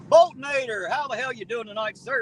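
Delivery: high, loud name call; excited greeting. Real, not acted. Streamer hailing a viewer in chat, loud excited call.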